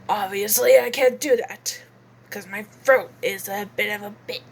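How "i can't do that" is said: ordinary talk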